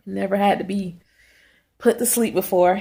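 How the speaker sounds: ordinary talk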